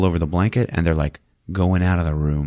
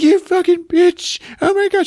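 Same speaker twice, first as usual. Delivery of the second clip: hammed-up furious screech; yelling insults; 5 dB louder than usual. Son to mother, high furious yelling. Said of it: "they're yelling at each other".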